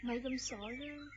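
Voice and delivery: hushed lover's tone; soft tender. Acted. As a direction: coax him gently, close and private. Lovers alone, hand in hand.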